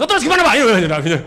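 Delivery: loud barked question, hammed up; mock snapping. Shown as a demonstration. Employee wrongly still shouting once inside, mock yelling. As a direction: keep yelling at him as a bad example. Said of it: "jangan juga diajak bentak-bentakan terus".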